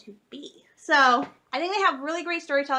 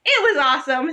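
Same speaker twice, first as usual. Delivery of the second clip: normal talking voice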